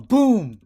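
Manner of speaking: loud, high "boom"; sudden booming hit; punch sound effect. Acted. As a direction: punch out the impact. Narrating a punch landing.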